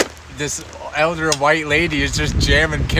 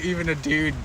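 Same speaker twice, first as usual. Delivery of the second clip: ordinary talk, nothing marked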